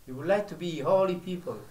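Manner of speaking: ordinary delivery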